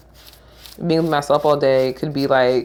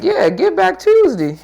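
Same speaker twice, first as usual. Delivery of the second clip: much higher, slow, "Tuesday" as "Tuesdi"; radio host impression; 14 semitones higher, 4 dB louder, slower than usual. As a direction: imitate the radio host's slogan. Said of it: "It's like".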